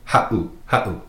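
"ha'u" with a little pause after ha; glottal pause. Shown as a demonstration. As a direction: catch the breath between ha and u in "ha'u". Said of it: "that pause".

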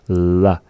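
La with the tongue held. Shown as a demonstration. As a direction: hold the L position. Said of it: "and hold it".

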